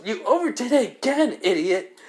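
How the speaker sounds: high-pitched exasperated telling-off; acted scolding. Kaneko telling Issei off for overdoing it, scolding. Girl scolds hero in story.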